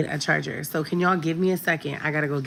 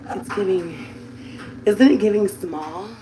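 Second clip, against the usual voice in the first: sassy; 4 semitones higher, slower than usual. Real, not acted. Admiring her own weight loss.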